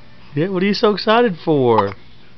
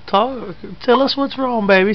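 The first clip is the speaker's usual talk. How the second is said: ordinary delivery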